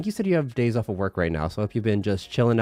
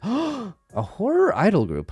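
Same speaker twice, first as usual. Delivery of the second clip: surprised gasp; 10 semitones higher, slower than usual. Real, not acted. Reacting to surprising news.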